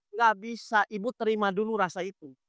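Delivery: ordinary talk, nothing marked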